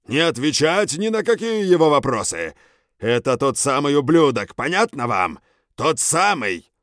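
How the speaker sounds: loud, high lizard-soldier yelling; angry creature shout. Acted. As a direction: rage at the men, full voice. Gorgun leader yells at soldiers.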